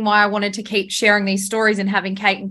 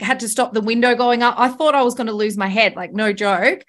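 usual speaking voice

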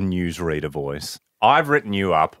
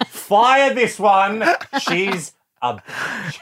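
mock boss aside; 10 semitones higher, much slower than usual. Anchor mock aside about reporter.

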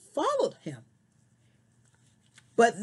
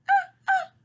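high, louder puppet squeak sounds; 4 dB louder than usual. Pretend. Puppet Brownie squeaks hello to the friends. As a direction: squeak like a little puppet dog.

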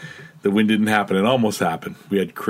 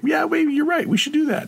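much higher, exaggerated mock voice; mock agreeing voice. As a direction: squeak it like everyone caving in. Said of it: "And everybody's like".

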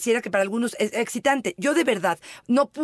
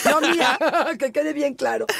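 laughing; 5 semitones higher than usual. Real, not acted. Laughing denial of joke.

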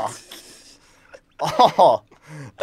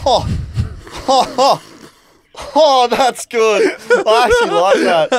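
laughing; 9 semitones higher, 5 dB louder, slower than usual. Real, not acted. Cracking up at the pun.

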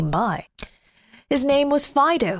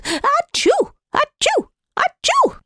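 high, loud sneezes, rising; big sneezes; 4 dB louder, slower than usual. Acted. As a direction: sneeze hard three times. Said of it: "sneezing as hard as he could".

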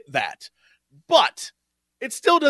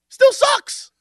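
suddenly higher and louder, fed up; exasperated outburst; 7 dB louder, slower than usual. Real, not acted. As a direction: blurt it out in disgust. Ranting about hated characters.

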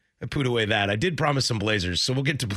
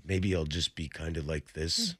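lower, softer aging voice; old deep voice; 8 semitones lower, 5 dB quieter than usual. Shown as a demonstration. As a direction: sound older, weaker, still deep. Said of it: "kinda like this, a little slower and a little less power".

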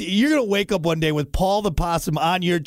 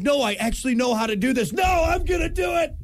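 eager doctor; 9 semitones higher than usual. Retold or imitated. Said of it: "a doctor actually comes up like".

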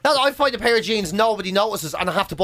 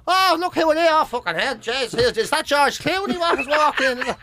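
high-pitched salesman's feigned astonishment; mock amazement; 5 semitones higher than usual. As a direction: gush as if spotting a film star. Salesman acts amazed at the customer.

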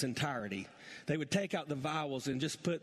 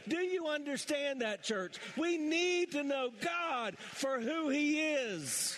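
litany rising steadily higher in pitch; building preaching; 8 semitones higher than usual. Acted. Pastor building to a climax for the church, rising preaching. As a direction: climb with every name of God, preaching harder.

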